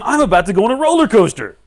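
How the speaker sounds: high, loud, rapid squeal of excitement; thrilled anticipation. Acted. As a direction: blurt it like you're next on the ride. Acting thrill before ride.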